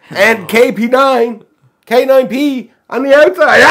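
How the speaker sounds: high, loud vampire declamation; slow build into a vampire cry; Dracula. Acted. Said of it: "Read the joke like Dracula".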